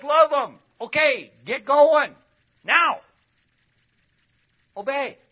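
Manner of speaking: loud, high commands barked out; commanding shout. Retold or imitated. Preacher voicing Jesus telling disciples to go, loud command. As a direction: order them to get moving now. Jesus urging believers to act.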